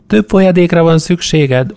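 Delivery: a hint of a practical young woman giving orders; young woman's voice. A narrator's reading. A young woman nursing a hungover man, lightly voiced. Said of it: "mondta a lány".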